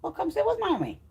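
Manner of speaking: cooing at her cat; pet talk. Real, not acted. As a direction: coo at the cat lovingly.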